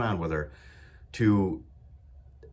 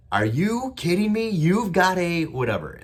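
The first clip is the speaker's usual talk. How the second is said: goofy loud excitement; 8 semitones higher, 6 dB louder than usual. Retold or imitated. Dad hyping up his moody daughter in the car, loud goofy disbelief. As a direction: play it up like a silly excited dad. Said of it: "I was saying it real loud, real goofy".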